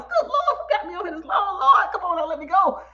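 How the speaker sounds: high, playful cry to the Lord; exasperated, high-pitched mock plea; mock pleading. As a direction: wail a half-joking prayer. Mock plea about long stream.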